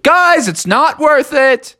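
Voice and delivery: slow, high, loud call. Acted. Froggy calls up from the sewer to his friends, calling out. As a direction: holler up to the guys.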